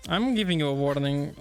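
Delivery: ordinary talk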